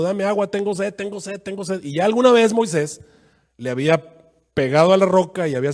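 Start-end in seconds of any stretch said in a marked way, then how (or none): 0.0-1.8 mock thirsty crowd. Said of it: "lo estaban todos gritando".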